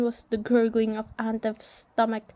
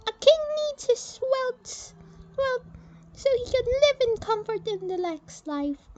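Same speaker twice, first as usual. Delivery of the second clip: a much higher, firm general announcing the burial; stern general voice. Acted. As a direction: speak as a proud Egyptian general, high and set.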